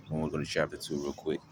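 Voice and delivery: usual speaking voice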